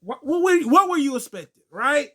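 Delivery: mock outraged shout at a noisy moviegoer; fed-up outburst. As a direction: tell the talker off, voice cracking up. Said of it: "everyone's just looking at him like".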